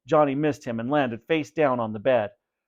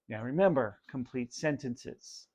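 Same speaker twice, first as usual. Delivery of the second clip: softer reminder; 9 dB quieter than usual. Shown as a demonstration.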